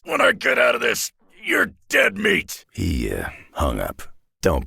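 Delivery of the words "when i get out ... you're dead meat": furious shout. Acted. Trapped Wolverine roaring a threat at his captor, furious shout. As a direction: bellow it like you'll tear him apart.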